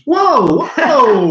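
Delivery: high, loud whoa whoa; playful mock protest at being blamed; mock alarmed whoa. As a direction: protest in fake shock. Mock protest at husband jab.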